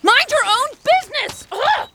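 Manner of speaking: high, sharp furious shout; angry outburst. Acted. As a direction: snap and storm off. Janie storms off.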